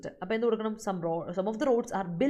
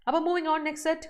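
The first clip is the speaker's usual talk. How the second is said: brisk announcement; 9 semitones higher, 5 dB louder than usual. Real, not acted. Teacher switching topic.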